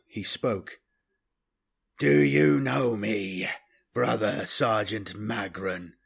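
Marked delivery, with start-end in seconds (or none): 2.0-5.9 slow, hollow questioning tone. Acted. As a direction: ask it slowly from an empty chest. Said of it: "he inquired in a hollow voice".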